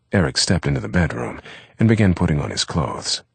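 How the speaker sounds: usual speaking voice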